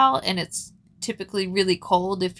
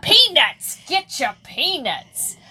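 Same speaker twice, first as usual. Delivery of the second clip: high vendor call, then slower; stadium vendor hawking; 6 semitones higher, 4 dB louder than usual. Retold or imitated. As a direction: sell peanuts to the crowd. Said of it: "the vendors going".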